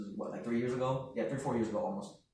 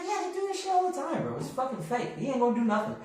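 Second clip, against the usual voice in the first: high, softer brother imitation; dismissive brother; 8 semitones higher, 6 dB quieter than usual. Brother saying the scammer will do nothing, mock dismissive. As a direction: wave away the worry, pitched up.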